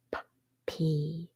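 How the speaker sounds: P whispered as a bare puff of p; whispered p sound. Shown as a demonstration. Teacher whispers the p sound to young readers. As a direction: breathe out just the p sound for P. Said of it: "P has one sound".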